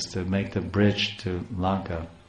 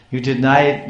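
mock tearful cry, higher and louder on denied; anguished protest; 5 semitones higher, 6 dB louder than usual. [name] pleading with the voice, a louder mock tearful cry. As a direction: lift denied into a pained cry. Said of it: "I'm crying".

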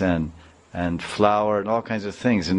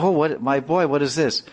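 higher, startled and worried; shocked concern; 7 semitones higher than usual. Retold or imitated. Wife finding blood on the boy's neck, shocked. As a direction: gasp over the boy's scratches.

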